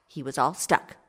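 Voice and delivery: plain reading voice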